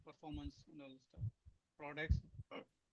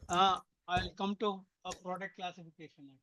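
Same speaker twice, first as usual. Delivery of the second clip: louder after being told the voice is low; speaking up; 3 semitones higher, 12 dB louder than usual. Real, not acted. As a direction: repeat it louder so it carries. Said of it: "Your voice is very low, Jay".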